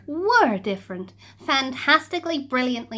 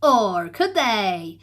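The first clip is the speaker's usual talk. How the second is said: ordinary delivery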